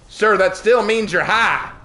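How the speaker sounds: slow, high Southern drawl; exaggerated Southern accent. Server setting a customer straight, exaggerated Southern drawl. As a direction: stretch it out in hammed-up Southern. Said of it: "I'll stop with my southern accent".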